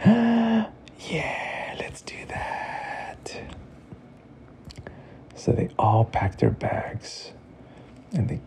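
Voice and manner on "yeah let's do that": high breathy whisper, slowing down; excited whisper. Acted. Teo secretly agreeing to the trip, high excited whisper. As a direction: whisper it full of delight.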